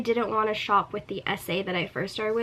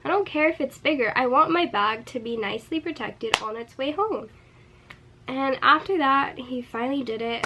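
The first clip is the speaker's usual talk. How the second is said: usual speaking voice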